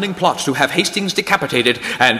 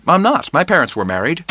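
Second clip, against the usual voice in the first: usual speaking voice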